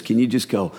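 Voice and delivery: ordinary talk, nothing marked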